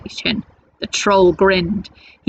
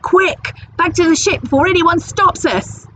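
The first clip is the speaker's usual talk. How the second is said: urgent pirate; 9 semitones higher than usual. Acted. Pirate urging crew away.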